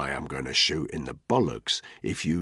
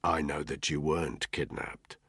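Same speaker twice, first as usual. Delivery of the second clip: low and cold; 4 semitones lower, 8 dB quieter than usual. A narrator's reading. Danny confronting Ntoga coldly.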